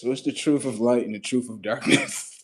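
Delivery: usual speaking voice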